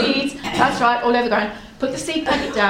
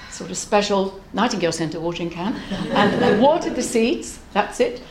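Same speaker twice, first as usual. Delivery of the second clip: ordinary delivery, nothing marked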